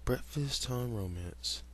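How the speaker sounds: plain reading voice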